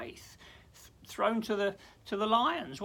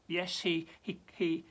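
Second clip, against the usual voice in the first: usual speaking voice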